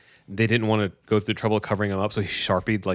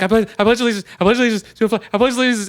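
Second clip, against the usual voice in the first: put-on high, loud panicked kid reciting; hammed-up urgency; frantic recitation; 12 semitones higher, 6 dB louder, faster than usual. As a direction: imitate a desperate boy repeating a pledge. Son frantically recites pledge over.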